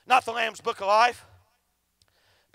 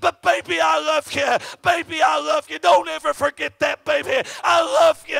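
lost father's farewell; 4 semitones higher, 6 dB louder than usual. Retold or imitated. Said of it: "their daddy looking back at them saying".

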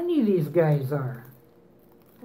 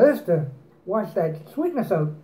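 glass held at lips; speaking while drinking; talking between sips of water. Real, not acted. Seen 1: Chewing unclear; he raises a water glass to his lips mid-sentence.

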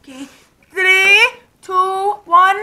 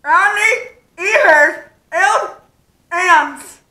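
mouth guard speech; much slower than usual. Real, not acted. Talking through mouth guard game.

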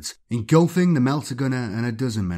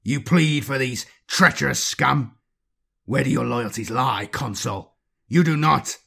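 hostile challenge; 3 semitones higher than usual. Acted. Inquisitor squaring up to the librarian, accusing. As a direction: sneer the accusation.

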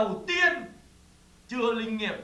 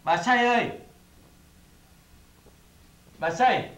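loud, drawn-out call for someone missing; 5 dB louder, slower than usual. Acted. Man calls for the missing Bà Say, calling out. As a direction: call out through the house, louder each time. Calling an absent woman repeatedly.

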